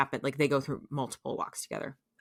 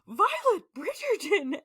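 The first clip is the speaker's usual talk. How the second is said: much higher, slow, shocked reproach; scandalized scolding; slower than usual. Acted. Lady Danbury scandalized by Violet's confession, high shocked reproach. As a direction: gasp her full name like a shocked friend.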